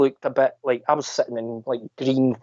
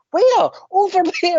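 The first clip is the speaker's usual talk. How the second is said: put-on squeaky kid exchange. Girl pointing out the elf to mum, squeaky and high. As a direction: squeak it like a tiny excited child.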